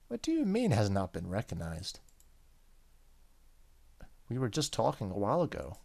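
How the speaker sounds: usual speaking voice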